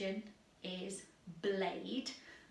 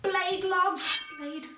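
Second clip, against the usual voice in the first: loud high shouted cues; playful game caller shouting; called-out commands; 8 semitones higher, 7 dB louder, much slower than usual. Acted. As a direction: call the obstacles out with excitement. Calling out game instruction.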